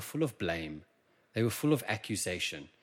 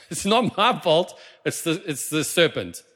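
higher, louder whiny excuse; Eve blaming; 6 semitones higher, 8 dB louder than usual. Retold or imitated. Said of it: "what does she do?".

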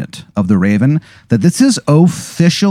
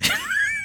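high cackle; 13 dB quieter than usual. Acted. Informant's laugh in character.

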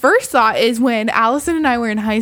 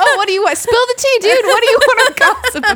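much higher, louder gossipy squeal; mock gossip girl; 13 semitones higher, 5 dB louder, faster than usual. Imitating herself asking the date for gossip, squealing. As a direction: play an eager gossip begging for tea.

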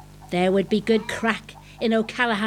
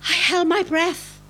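ordinary delivery

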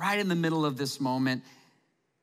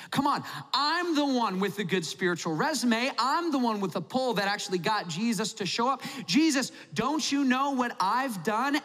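indignant Simon; 4 semitones higher than usual. Retold or imitated. Slighted host Simon complaining to Jesus, hammed-up indignation. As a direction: demand Jesus notice you, wounded pride. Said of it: "you're looking at this moment and you're like".

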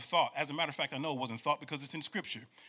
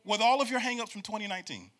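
rising, louder preacher cadence, almost singing; full-force preaching; 9 semitones higher, 4 dB louder than usual. Acted. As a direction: build the sermon to a shout. Preaching, calling for response.

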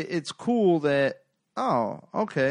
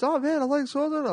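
much higher-pitched friend's voice; imitating excited friend; 14 semitones higher than usual. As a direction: mimic a buddy in a high, eager voice. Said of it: "he's, like, rattling off names".

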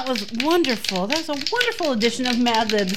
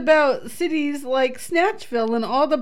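rereading it on request while still crying with laughter; fighting to read it with the giggles; reading through tears of laughter. Real, not acted. Cohost asks her to reread the Mad Libs, laugh-crying. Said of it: "read that again".

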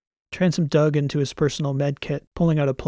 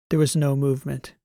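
plain reading voice

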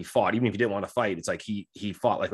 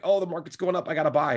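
higher, excited mock investor rushing to buy; mock eager investor; 6 semitones higher than usual. Average investor rushing to buy, in a higher mock-excited voice. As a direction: play the naive buyer chasing a rising market. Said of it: "they're like".

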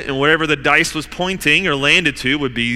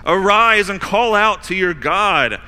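urgent command; 8 semitones higher, 6 dB louder, slower than usual. Acted. Captain urging Jonah.

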